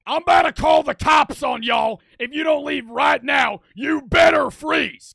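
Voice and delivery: loud, high full-throated shout; angry yelling. Retold or imitated. Homeowner threatening walkers with police, angry shouting. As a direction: holler threats across a dark road.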